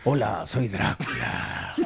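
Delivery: very slow, low, soft vampire greeting; Drácula. Acted. Host redoes Dracula for jumpy colleagues, slow and hushed. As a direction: draw every word out, creepy and subdued. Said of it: "así como hablaba Drácula".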